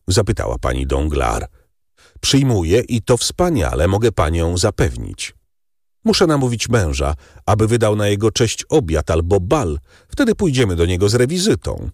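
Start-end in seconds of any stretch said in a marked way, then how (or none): 2.2-11.9 lightly voiced baroness. A narrator's reading. Baroness plans a visit.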